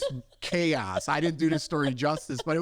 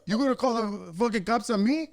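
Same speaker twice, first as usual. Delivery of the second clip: mock-offended, pitched up; retold indignation; 7 semitones higher than usual. Dad who thinks cops are being called, indignant. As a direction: demand it in raised-pitch disbelief. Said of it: "he got all pissed off about it".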